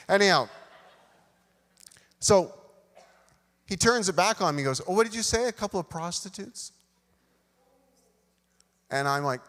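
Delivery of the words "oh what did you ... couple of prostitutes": high-pitched retold disbelief; mock incredulous. Big coworker questioning the new teen, mock incredulous. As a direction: pitch it up, pouncing on the misheard word. Coworker mishears and pounces on answer.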